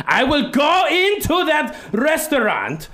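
put-on sudden high accented shout; Pepe Le Fuck You. Rude customer threatens drive-through staff, high mock-furious yell. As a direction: explode into a loud, high cartoon threat. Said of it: "he is very aggressive".

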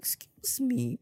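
a sudden high, incredulous "Excuse me?"; mock offended. Reacting to a friend upset over no hi, mock offended disbelief. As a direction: act scandalized at the pettiness.

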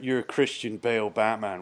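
ordinary delivery

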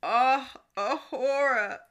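dramatic catchphrase. Retold or imitated.

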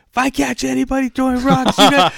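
high-pitched mock yell at a group; retold yell. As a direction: holler a warning, acting out the story. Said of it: "yell at everybody".

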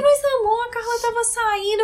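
high, pleading mock boyfriend voice; clueless boyfriend. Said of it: "Aí ele". (imitando o namorado confuso, voz aguda)

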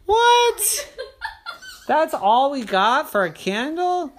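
very high, loud laughing squeal; laughing disbelief. Acted. Terry sees the birthday candle, high laughing squeal. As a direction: crack up at the tiny candle. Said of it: "Terry reacts, laughing".

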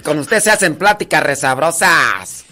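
put-on Tarimoro village woman; Tarimoro accent. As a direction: flatter the padre in a lively imitated Tarimoro lilt. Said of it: "la de Tarimoro se habla así".

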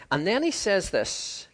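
ordinary delivery

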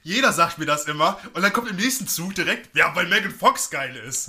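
laut und empört drauflos schimpfen; aufgebrachter Ausruf. Real, not acted. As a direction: sich lautstark über alle aufregen. Hitzige Beschwerde über Michael-Bay-Fans. (empört und laut)

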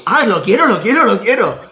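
snatch the offer gladly; eager acceptance. Retold or imitated. (aceptando con entusiasmo, más agudo y fuerte)

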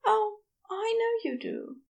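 high, drowsy character voice; sleepily reassuring, pitched well up; sleepy Aziraphale. A narrator's reading. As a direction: murmur it fondly in a high, sleepy lilt. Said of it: "Aziraphale said sleepily".

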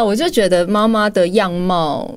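normal talking voice

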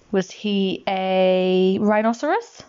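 slow build to RHINOCEROS, rising at the end; drawn-out lead into rhinoceros as a playful guess; teasing question. Shown as a demonstration. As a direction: hold back before rhinoceros, then tip it up.

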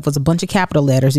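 ordinary delivery

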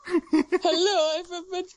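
high mock Borat-like accent, laughing. Friend mimicking the man who sounded like Borat, high accent. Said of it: "He sounded like Borat".

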